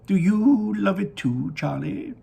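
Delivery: put-on high, slow, delighted old showman; Willy Wonka voice. Wonka gazing down at his factory, high and delighted. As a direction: sing it out like a proud candy maker.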